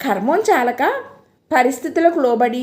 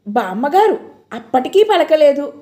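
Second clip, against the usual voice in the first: usual speaking voice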